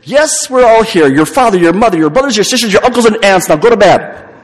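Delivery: high, loud exasperated rant; impatient passenger. Acted. Impatient passenger snapping at the girl to sleep, loud and high. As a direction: blast it like a fed-up passenger wanting sleep. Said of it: "an impatient passenger".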